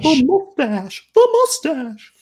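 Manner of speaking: very high, drawn-out falsetto; comic high-pitched echo. Acted. Co-host echoing the Magnum answer, silly falsetto. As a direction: squeal it slowly as a silly refrain.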